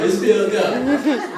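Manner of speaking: slow, drawn-out words through laughter; laughing slowly. Real, not acted. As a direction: stretch it out while cracking up. Repeats punchline slowly laughing.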